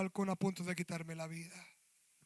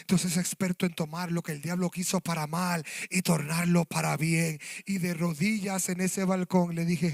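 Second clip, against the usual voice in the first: loud, driving preacher's testimony; predicación encendida; 9 dB louder than usual. Acted. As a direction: preach it at full force, voice climbing. Preaching again at full force. (predicación a todo pulmón)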